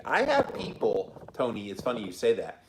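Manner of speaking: normal talking voice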